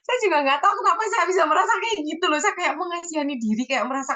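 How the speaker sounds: tearful laugh at herself; laughing through tears. Real, not acted. Confessing self-pity to friends, laughing through tears.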